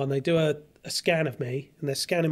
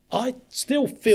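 high, loud, drawn-out protest; insistent protest; 8 semitones higher, 5 dB louder, slower than usual. Retold or imitated. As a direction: stretch each word out, pitched up and insistent. Acted protest to the specialists.

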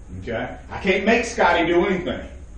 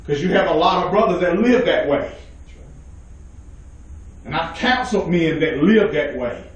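ordinary delivery

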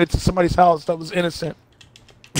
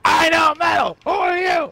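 mock indignant shout; 7 semitones higher than usual. Imitated rocker shouting back.

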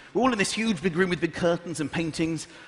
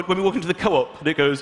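ordinary delivery

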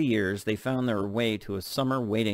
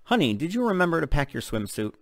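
mom voice; 6 semitones higher, 3 dB louder than usual. Acted. Mother voiced in story.

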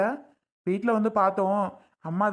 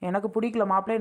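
normal talking voice

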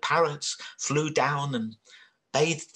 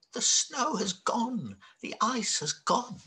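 amazed; 9 semitones higher than usual. Acted. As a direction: sound astonished at the change. Animals realize winter is over.

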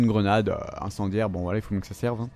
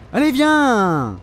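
drawn-out, pitched-up yell; slow, raised "come on"; exasperated challenge; 3 semitones higher, 3 dB louder, much slower than usual. Real, not acted. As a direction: stretch it into an impatient taunting call. Shouts the same call at enemy.

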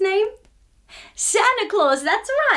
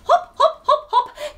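high bouncy hop; 12 semitones higher, 4 dB louder than usual. Pretend. Mimics bunny hopping playfully.

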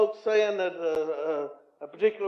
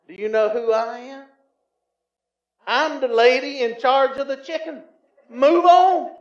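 stern; 4 semitones higher, 7 dB louder than usual. Retold or imitated. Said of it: "replied sternly".

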